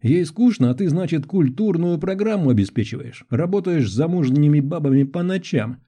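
high-pitched scolding woman. A narrator's reading. Woman berating man over night work, indignant. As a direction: lay into him, pitch held high. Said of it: "Голос женщины стал ещё громче".

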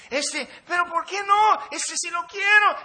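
put-on high, loud, laughing little-girl begging; squealing child pleading for a toy; whiny little girl. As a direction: beg like a greedy child in the store. Child protesting.

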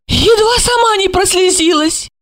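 high-pitched scandalized woman; high indignant character voice. A narrator's reading. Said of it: "возмутилась она".

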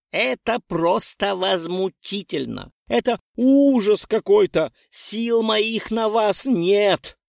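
put-on exasperated old witch scolding students; indignant outburst. A narrator's reading. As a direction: boil over with righteous fury. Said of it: "изливала свой праведный гнев".

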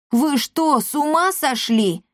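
high-pitched, taken-aback question; incredulous. A narrator's reading. Pygmalion to the attacking cats, incredulous. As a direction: ask it in disbelief.

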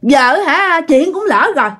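high-pitched, insistent ordering; possessed woman's demands. Retold or imitated. Spirit in the neighbour demands offerings from the widow, high and insistent. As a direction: act out a spirit laying down demands. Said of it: "Thì lúc đó mới quay qua, nói".

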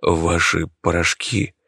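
plain reading voice

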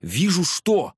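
high, excited exclamation; crying out. A narrator's reading. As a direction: burst out with the answer. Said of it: "хором выкрикнули пассажиры".

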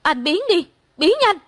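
high, acted yell building in pitch; high-pitched hysterical dismissal; screaming. A narrator's reading. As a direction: drive them away with a rising shout. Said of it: "Tôi la hét vang cả phòng".

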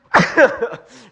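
sudden loud cough into mic; a sharp real cough. Real, not acted.

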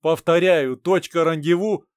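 loud, firm radio reply; over walkie talkie. Acted. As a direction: refuse firmly over the radio.

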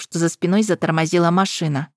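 usual speaking voice

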